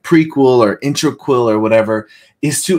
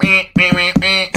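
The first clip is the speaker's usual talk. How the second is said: higher nasal-free buzzer meh sounds; buzzer noise; 7 semitones higher than usual. Pretend. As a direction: play a game-show buzzer. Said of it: "I needed a buzzer to be like".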